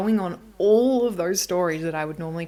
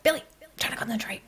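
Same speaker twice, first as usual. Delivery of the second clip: snappy; 5 semitones higher, faster than usual. Retold or imitated. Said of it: "I got really snappy".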